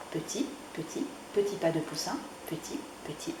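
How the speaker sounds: soft whisper fading out; whispered ending. A narrator's reading. Narrator whispering the little steps home at the end. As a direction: let the ending die away.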